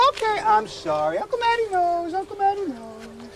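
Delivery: high, slow, cooing apology to a frightened dog. Real, not acted.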